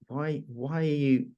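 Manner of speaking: mock indignant. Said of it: "You're gonna be like".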